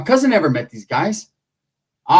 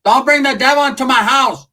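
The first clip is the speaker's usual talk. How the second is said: imitating the dad; 7 semitones higher than usual. Dad yelling at the kids to stop the Ouija talk. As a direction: yell it like a fed-up father at the table. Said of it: "My dad's like".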